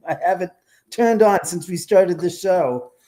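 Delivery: laughing through the words; amused, laughing it off. Real, not acted. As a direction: chuckle while telling it. Laughing about the bell going off.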